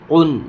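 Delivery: قن with a heavy back-of-throat q, "qun"; full-mouthed qaf. Shown as a demonstration. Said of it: "تو اس کو قن پور پڑھیں گے".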